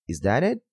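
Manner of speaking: "it" swings up at the end; rising intonation. Shown as a demonstration. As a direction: ask "Is that it?" on a rise. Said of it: "vamos tratar da rising intonation". (entonação crescente em "Is that it?")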